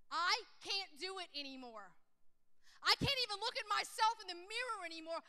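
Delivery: pent-up despair, rising in pitch and force; breaking down. Retold or imitated. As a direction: retell the anguish, swelling as it spills out. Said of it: "that I broke for a minute".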